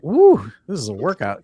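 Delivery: normal talking voice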